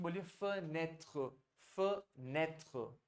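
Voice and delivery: slow fenêtre with a soft final tr; fenêtre drawn out slowly, French style. Shown as a demonstration. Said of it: "खिड़कियों को बोलते हैं French में". (धीरे से फ्रेंच fenêtre)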